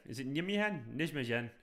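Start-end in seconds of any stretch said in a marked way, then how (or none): 0.3-1.0 "Nijmegen" as NIM-hen, softer; trying a pronunciation. Shown as a demonstration. Streamer questioning a Dutch name, "Nijmegen" as NIM-hen. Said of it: "Is that how you pronounce it?". 1.0-1.5 "Nijmegen" as NIZH-muh-yen; softer "Nijmegen" with zh, then y; trying a pronunciation. Shown as a demonstration. Streamer testing a Dutch name, "Nijmegen" as NIZH-muh-yen. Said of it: "Is that how you pronounce it?".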